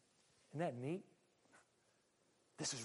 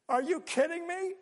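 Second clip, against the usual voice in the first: can't believe the command; shocked outburst; incredulous; 9 semitones higher than usual. Real, not acted. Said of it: "reaction to secret".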